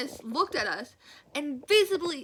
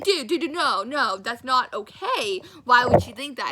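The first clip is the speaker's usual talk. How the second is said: loud, pleading no no; mock protest; 8 dB louder than usual. Telling the boy off, loud retold protest. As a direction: push back hard on the idea.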